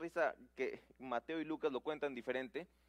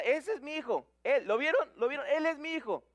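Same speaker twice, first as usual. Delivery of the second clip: higher, louder cheering from the stands; proud father shouting; 9 semitones higher, 7 dB louder than usual. Retold or imitated. Dad in the stands shouting after his son scores, beaming. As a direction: shout it like a dad bursting with pride. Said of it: "qué dice el papá en la grada".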